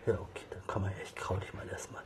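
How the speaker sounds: soft whisper to a kitten. Real, not acted. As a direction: hush and calm her gently. Soothing a nervous kitten.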